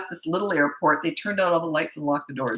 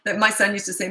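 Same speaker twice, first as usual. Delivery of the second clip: ordinary delivery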